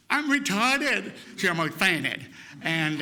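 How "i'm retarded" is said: high, slow, beaming announcement; proud kid. Retold or imitated. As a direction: announce it like a delighted child. Kid proudly tells his mother.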